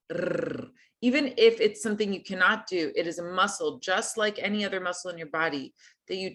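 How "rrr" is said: rolled R. Shown as a demonstration. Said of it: "train this muscle to do the rolled R".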